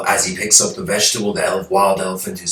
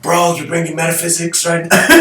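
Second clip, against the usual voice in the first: incredulous jab; 9 semitones higher than usual. Retold or imitated. Exasperated man mocks the answer.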